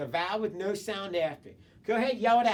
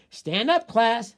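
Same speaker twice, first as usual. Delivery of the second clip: teacher calling her class to stand, louder; teacher's command; 2 semitones higher, 8 dB louder, slower than usual. Acted. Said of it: "said Missus Clark".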